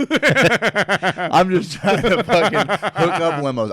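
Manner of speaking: high, slow, laughing through the words. Real, not acted. Telling friends about dodging everyone, laughing. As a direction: crack up while protesting. Cracks up retelling his excuse.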